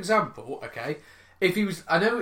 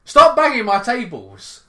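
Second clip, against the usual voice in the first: loud, higher, drawn-out telling-off; raised-voice, slow exasperated order; exasperated scolding; 5 semitones higher, 9 dB louder, slower than usual. Real, not acted. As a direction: scold your co-host, really fed up. Co-host keeps banging his table.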